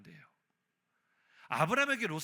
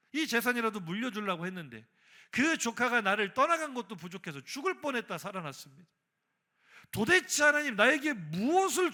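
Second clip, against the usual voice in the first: higher, pressing complaint to God; angry confrontation; 4 semitones higher, 4 dB louder than usual. Acted. Preacher as Abraham confronting God over empty promises, angry. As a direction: argue back as the aggrieved Abraham. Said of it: "아브라함이 화가 단단히 났어요".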